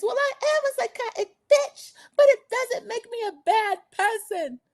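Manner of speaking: very high mock wail; hammed-up sobby squeal; Kimmy crying. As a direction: imitate a tearful reality-show confession in a put-on accent. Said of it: "trying to do their accents and these loud, horrible arguments".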